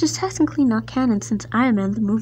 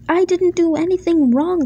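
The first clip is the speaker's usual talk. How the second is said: higher, louder indignant retort; snapping; 7 semitones higher, 6 dB louder than usual. A narrator's reading. Edward protesting his innocence to the controller, snapping. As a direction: snap back in self-defence. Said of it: "snapped Edward".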